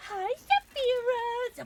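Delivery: high, slow sing-song hello; sweet greeting. Real, not acted. Streamer greeting a chat viewer, high and slow. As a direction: croon the name sweetly.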